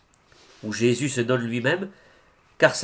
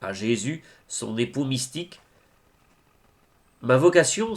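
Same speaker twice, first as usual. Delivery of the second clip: ordinary talk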